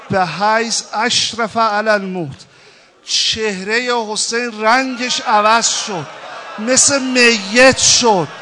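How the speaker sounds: loud, high, impassioned mourning sermon; full-force preaching. Acted. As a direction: build the lament to a cry.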